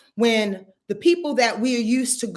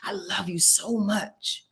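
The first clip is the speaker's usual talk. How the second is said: much softer tender affirmation fading to a whisper; 6 semitones lower, 6 dB quieter than usual. Shown as a demonstration. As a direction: say it lovingly into the mirror, ending in a whisper. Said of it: "look in the mirror and say".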